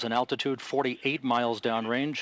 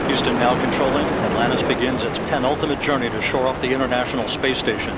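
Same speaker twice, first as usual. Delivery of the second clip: excited commentary; 6 dB louder than usual. Real, not acted. Commentator right after liftoff.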